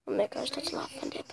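fast whispered amazement; whispering. Real, not acted. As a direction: whisper it quickly in awe.

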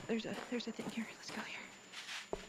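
hushed, voiceless whisper; very soft, wary whisper; nervous whisper. Real, not acted. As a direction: whisper it as if creeping along. Creeping up on a thing.

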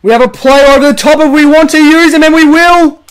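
excited commentator. Acted. Calling the play for his viewers, excited. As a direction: build it up like a TV caller.

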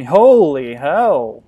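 very loud, drawn-out cry of shock; slow, booming astonishment; shocked exclamation. Real, not acted. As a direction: stretch both words out in a startled yell. Player shocked by sudden game event.

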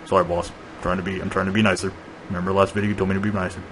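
softly apologetic dinosaur, lightly voiced; Big Mike. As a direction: mumble a sheepish apology to the boss.

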